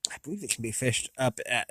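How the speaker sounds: usual speaking voice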